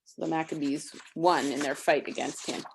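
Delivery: usual speaking voice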